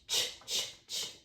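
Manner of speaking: "Ch" said as a sharp ch, tongue stopped; ch sound. Shown as a demonstration. Teacher sounding out ch for the class. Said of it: "I think it's C H".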